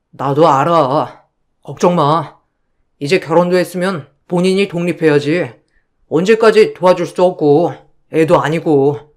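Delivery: low, calm husband voice; husband reassuring his wife, low and calm. Acted.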